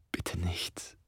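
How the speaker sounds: leises, gehauchtes Flüstern; leise abbrechend. Acted. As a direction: flehend flüstern. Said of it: "brach sie ab". (leise gehaucht)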